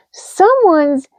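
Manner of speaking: slow high loud mouse call; baby mouse calls, squeaky. A narrator's reading. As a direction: call out the news. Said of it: "called a baby mouse".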